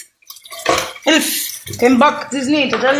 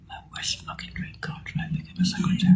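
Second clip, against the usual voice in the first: whispered aside; 12 dB quieter than usual. Real, not acted. Bitter aside.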